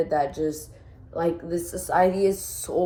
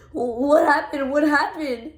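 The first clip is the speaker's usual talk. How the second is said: higher-pitched, retold near-crying question; near tears; 7 semitones higher than usual. Retelling her partner asking what happened, imitated near tears. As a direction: imitate someone on the verge of crying. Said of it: "on the verge of, like, crying".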